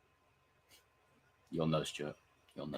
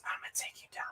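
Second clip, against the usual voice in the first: unvoiced menacing whisper; 15 dB quieter, faster than usual. Streamer playfully threatening chat, hushed whisper. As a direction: breathe the threat softly.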